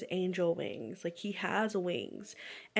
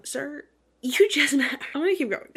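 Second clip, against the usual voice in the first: higher, louder outburst at the book; głośny wybuch; 6 semitones higher, 8 dB louder than usual. Real, not acted. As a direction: blurt it out, incredulous at the character.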